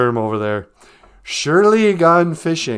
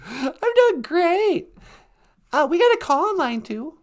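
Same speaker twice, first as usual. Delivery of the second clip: high put-on voice.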